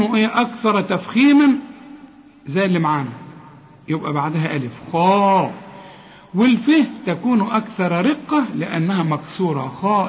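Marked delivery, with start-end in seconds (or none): none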